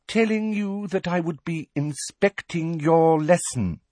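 condescending slow voice. A narrator's reading. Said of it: "in the same loud, slow voice".

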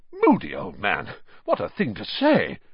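ordinary delivery

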